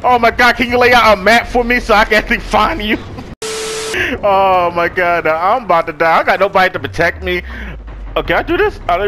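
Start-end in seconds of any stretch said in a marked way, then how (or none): 3.0-4.2 high breathy giggle; laughing. Real, not acted. Player laughs at being lost, high airy giggle.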